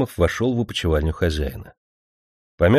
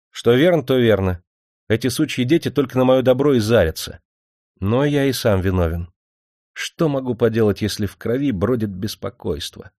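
ordinary delivery, nothing marked

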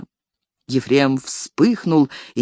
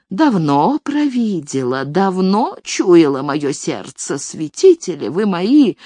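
put-on scolding old nanny; 2 semitones higher than usual. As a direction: keep railing at her like a nanny. Nanny keeps scolding Elis.

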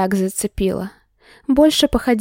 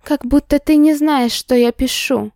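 scornful snort; 5 semitones higher than usual. Acted. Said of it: "фыркнула она".

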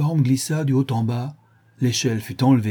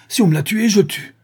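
high, loud threat; enraged yell; 11 semitones higher, 7 dB louder than usual. A narrator's reading. Sholmes over his stabbed companion Wilson, yelling in fury. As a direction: burst out in a vengeful shout. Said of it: "hurla Sholmes".

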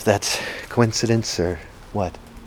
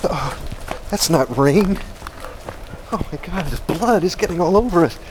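horrified screaming; 10 semitones higher than usual. Acted. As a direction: scream in horror. Blood pouring on them.

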